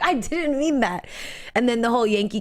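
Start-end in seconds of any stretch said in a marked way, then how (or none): 0.0-1.0 slow, higher flustered denial; imitating him. Retelling a man's flustered denial, mock sheepish. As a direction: back off it sheepishly. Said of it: "He's like".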